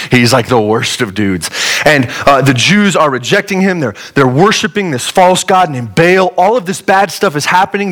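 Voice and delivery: ordinary delivery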